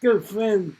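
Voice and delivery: showman announcer. Acted. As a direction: hype up the crowd like an emcee. Host introducing act to audience.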